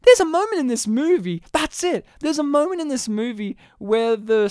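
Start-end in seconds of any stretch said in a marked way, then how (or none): none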